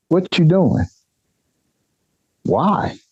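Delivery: high, indignant mock question. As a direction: fire it back, offended and high. Mocking a nosy stranger.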